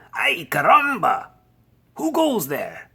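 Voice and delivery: high, loud exclamation; startled challenge. A narrator's reading. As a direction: cry out and challenge the strangers. Hero challenges mysterious Chihuahua band.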